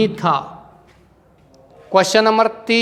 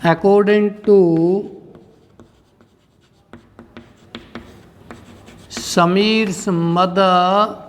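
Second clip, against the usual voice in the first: slow phrases with long pauses between them; drawn-out, measured dictation; 4 dB quieter, slower than usual. Shown as a demonstration. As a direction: dictate the answer for students to write down. लिखिए इसका answer.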